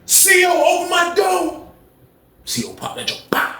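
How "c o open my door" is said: very high holler; imitated high-pitched shout; calling the guard. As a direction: yell down the tier to the officer. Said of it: "he said".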